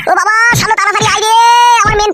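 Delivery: high, pained cry for help; desperate yelling. Acted. Husband calling his father to save him, desperate yelling. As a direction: holler urgently for rescue, pitched high.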